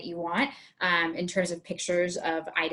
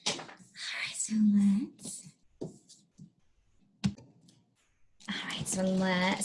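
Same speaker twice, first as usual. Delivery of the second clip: whispered aside; 5 dB quieter than usual. Real, not acted. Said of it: "whispered aside".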